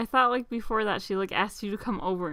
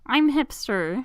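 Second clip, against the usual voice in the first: mock hipster girl; 5 semitones higher, much slower than usual. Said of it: "and is like".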